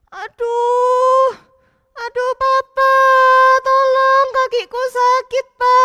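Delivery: put-on high, loud, slow child cries for Papa; hurt boy calling. Matthew calling his papa for help, hurt and whimpering. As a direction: call for help through the pain.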